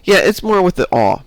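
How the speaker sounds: ordinary talk, nothing marked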